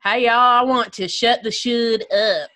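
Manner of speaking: slow, stretched Texan drawl; Texan accent. Pretend.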